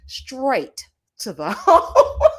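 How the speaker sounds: drawn-out words collapsing into laughter; breaking into laughter. Real, not acted. Host retelling being sent straight to the home, cracking up. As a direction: stretch it slowly till the laugh takes over.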